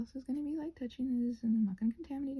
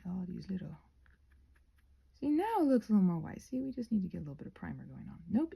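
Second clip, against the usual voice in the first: ordinary talk, nothing marked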